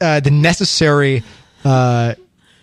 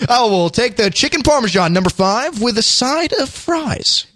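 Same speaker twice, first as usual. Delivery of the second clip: slow, higher announcer delivery; radio-voice dinner order; 6 semitones higher, slower than usual. Pretend. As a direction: read the order out like an ad. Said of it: "That's what I do at dinner".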